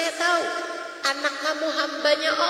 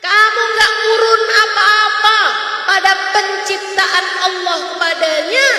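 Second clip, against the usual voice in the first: forceful preaching; 3 semitones higher, 5 dB louder than usual. Acted. Preacher rebuking mothers, loud and rising. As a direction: hammer each line home to the mothers.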